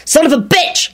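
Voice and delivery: high-pitched furious curse; wild yell. A narrator's reading. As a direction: let the curse fly wildly. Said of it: "Lupin yelled wildly".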